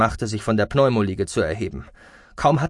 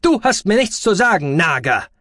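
high, loud snapping; strident, scolding doctor; nagging screech; 10 semitones higher, 7 dB louder than usual. Acted. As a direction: snap back shrewishly. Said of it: "keifte der Ara".

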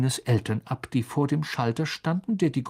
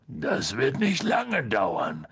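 slow giant's voice with a grin; Hagrid grinning; much slower than usual. A narrator's reading. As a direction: draw it out, good-humoured rumble. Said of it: "meinte Hagrid grinsend".

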